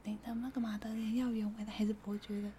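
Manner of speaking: unvoiced timid cashier whisper, repeated; tiny whisper. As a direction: whisper it again, barely there. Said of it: "他讲话就是这么小声". (重复一遍的细声耳语)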